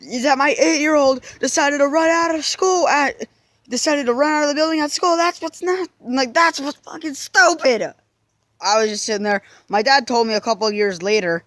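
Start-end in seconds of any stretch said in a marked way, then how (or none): 0.0-7.9 a furious mom yelling at her son; angry mother ranting. Retold or imitated. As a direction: shriek it at the kid in fury. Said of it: "sat there screaming in my face".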